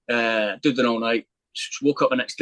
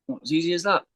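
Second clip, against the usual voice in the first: higher, disbelieving questions; can't believe it's that easy; incredulous; 6 semitones higher than usual. Retold or imitated. Told the officer moved on, asking in high amazed disbelief.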